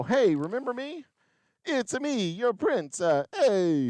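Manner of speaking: high-pitched, hammy fake Italian; bad Italian accent. Prince greeting his townspeople, in a bad Italian accent. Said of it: "I don't know why he's Italian all of a sudden, but, you know, badly Italian".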